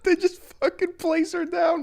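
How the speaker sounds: talking through a high laugh. Real, not acted. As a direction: squeal the words while laughing. Streamer laughing, voice jumps up.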